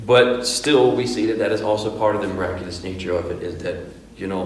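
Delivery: ordinary talk, nothing marked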